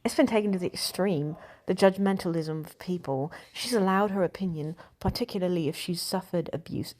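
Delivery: put-on British accent for a quote. Shown as a demonstration. Reading an actress's quote to The Times, British accent. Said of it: "let me do that in a British accent".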